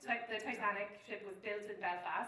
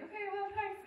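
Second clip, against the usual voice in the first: awkward high thanks; 10 semitones higher than usual. Retold or imitated. Awkward reply.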